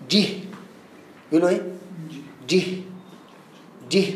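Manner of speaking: "جه" as one short syllable closed by a breathy h; voweled letter with silent h. Shown as a demonstration. Teacher modelling the answer for students, "جه" with silent h. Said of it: "يقول لك إيه؟".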